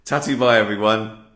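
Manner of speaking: playful loud sign-off; cheery goodbye. Real, not acted. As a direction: wave them off brightly. Jokey sign-off to viewers.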